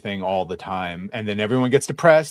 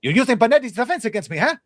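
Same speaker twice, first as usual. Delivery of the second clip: much higher, fast fencing banter; movie duel quote; 11 semitones higher, 3 dB louder, faster than usual. Retold or imitated. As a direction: rattle it off like a dueling swordsman. Quoting Princess Bride duel.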